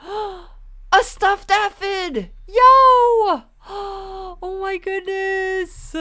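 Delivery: high, slow gasping squeal; excited squeal. Real, not acted. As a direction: squeal with delight at a cute toy. Finds stuffed aphid, squeals.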